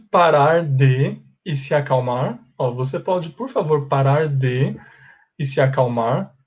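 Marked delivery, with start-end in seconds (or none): none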